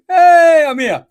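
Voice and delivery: high, very loud hail; excited calling out. Real, not acted. Host to viewers who can still hear her, excited calling out. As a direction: sing out loudly to the audience, pitched high.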